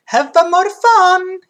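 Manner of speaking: put-on high, loud, drawn-out Italian accent; sing-song cartoon Italian, slow and high; Mario. Barber answering the customer, Mario-like Italian voice. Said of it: "made him sound like Mario there".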